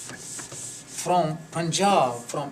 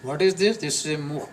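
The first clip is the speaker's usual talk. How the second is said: usual speaking voice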